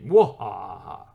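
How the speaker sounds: loud slow evil cackle; mad scientist laugh. Pretend. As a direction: cackle like a cartoon villain. Villain laugh after secret chart joke.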